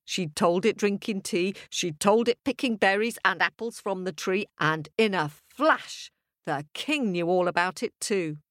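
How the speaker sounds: usual speaking voice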